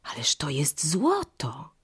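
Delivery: slow, hushed gasp of amazement; astonished exclamation. A narrator's reading. Mother touches the feather, hushed and astonished exclamation. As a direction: exclaim under your breath, drawn out. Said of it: "wykrzyknęła".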